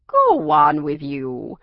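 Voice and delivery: playful fake annoyance; mock scolding. A narrator's reading. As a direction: shoo them off, only pretending to be annoyed. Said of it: "she scolded, pretending to be annoyed".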